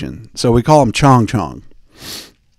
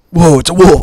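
louder, higher alarmed cry; mock alarm; 6 semitones higher, 7 dB louder than usual. Cavemen spotting a wolf at the fire, mock alarm. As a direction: blurt it out as if startled, half playing.

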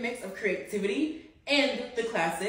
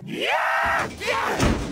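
excited cheering; 9 semitones higher than usual. Acted.

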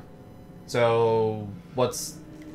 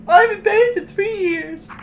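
put-on little girl voice. Joins the mock girl reading.

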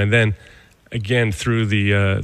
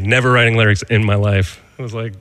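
ordinary talk

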